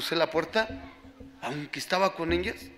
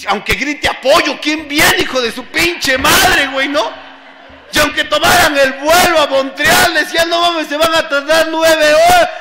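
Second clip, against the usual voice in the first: loud, high-pitched outburst; impassioned shouting to the room; worked-up rant; 10 semitones higher, 14 dB louder than usual. Real, not acted. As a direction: rail at full volume. Worked-up aside to the group.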